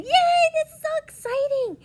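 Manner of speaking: high, loud cheer; excited voice. Shown as a demonstration. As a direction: burst with joy.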